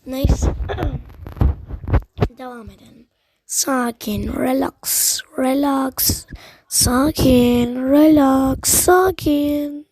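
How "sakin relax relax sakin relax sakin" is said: drawn-out words building to loud; tense calming chant that swells louder; forced self-calming. Real, not acted. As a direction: loudly order yourself to relax, stretching each word. Gamer forcing himself to calm down.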